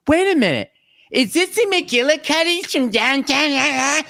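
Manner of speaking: hammed-up wife catching on; suspicious wife. Husband mimicking wife recognizing the cafe, suspicious. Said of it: "She's like".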